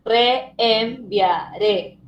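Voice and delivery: "Reenviaré" very slow, re, en, via, ré; syllable by syllable. Shown as a demonstration. Teacher answering how it separates, "Reenviaré" in separated syllables. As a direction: leave gaps between syllables of "Reenviaré". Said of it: "¿Cómo separarías reenviaré?".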